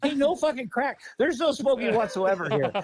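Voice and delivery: high, insistent retold ultimatum; retold stern warning. Rescuer setting house rules for an addict, high insistent warning.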